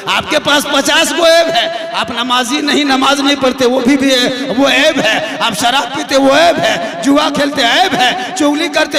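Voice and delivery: loud, rising list of sins, hammered line by line; preaching at full force. Acted. Preacher thundering at the faithful about their own faults, loud and relentless. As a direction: thunder each fault at the crowd.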